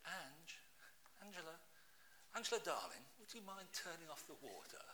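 soft sweet-talking his wife; gentle polite request. Retold or imitated. Preacher imagining asking his wife gently about the water. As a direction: coax her kindly, softly. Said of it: "I'd have just said".

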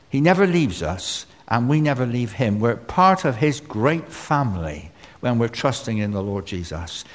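ordinary talk, nothing marked

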